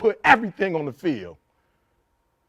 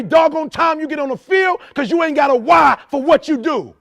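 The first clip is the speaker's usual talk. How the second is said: preaching at full force; 9 semitones higher than usual. Acted. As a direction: hammer every line home to the team like a pulpit sermon. Motivational speech to football team.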